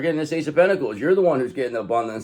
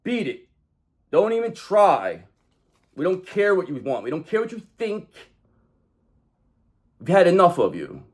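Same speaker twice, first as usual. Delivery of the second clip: raised, declaring voice, each line a command; firm affirmation; 5 semitones higher than usual. Acted. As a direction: banish them with firm declarations.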